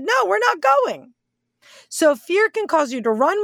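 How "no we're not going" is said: high, mock-timid "no, we're not going"; scared spies refusing, lightly mocked; mock chicken refusal. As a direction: play the cowards backing out, pitched high. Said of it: "The rest were chickens and said".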